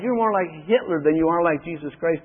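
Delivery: ordinary talk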